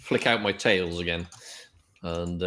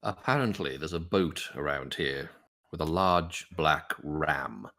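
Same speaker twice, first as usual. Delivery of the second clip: formal adventurer; 5 semitones lower, 4 dB quieter than usual. Acted. Adventurer tells stranger of the boat, low and measured. Player informs stranger in character.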